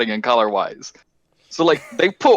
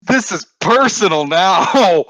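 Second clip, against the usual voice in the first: slow, drawn-out indignant shout; mock outrage; 4 semitones higher, 6 dB louder, much slower than usual. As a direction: play it up like a personal insult. Mock outrage over fake account.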